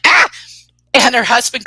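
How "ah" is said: one short mock shriek; freaked-out yelp. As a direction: imitate a sudden panicked scream. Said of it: "She's totally freaking out".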